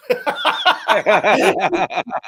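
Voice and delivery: loud open laugh; a burst of fond real laughter. Real, not acted. Father laughing about his starstruck son, loud real laugh.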